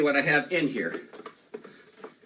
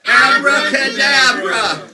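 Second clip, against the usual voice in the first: slow, loud Abracadabra; shouted magic word; 9 semitones higher, 11 dB louder, much slower than usual. Acted.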